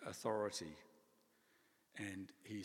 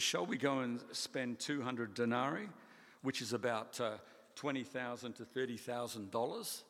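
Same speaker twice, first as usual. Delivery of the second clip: louder, mocking incredulity; sarcastic; 2 semitones higher, 6 dB louder than usual. Disciples sarcastically question Jesus's plan, loud sarcastic. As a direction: scoff at the cost, mock the idea. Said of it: "You note the little sarcasm".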